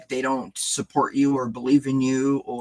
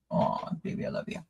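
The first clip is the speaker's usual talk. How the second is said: whisper; 9 dB quieter than usual. Real, not acted. Telling the cat she loves it, in a soft whisper. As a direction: breathe it to the cat lovingly.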